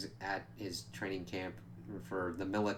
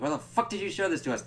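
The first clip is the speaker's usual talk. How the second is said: higher, fast, exasperated demand; mock furious question; 9 semitones higher, 3 dB louder, faster than usual. Laszlo scolding Nandor for showing the curse, mock anger. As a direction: fire it off in imitated outrage. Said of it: "Laszlo's like".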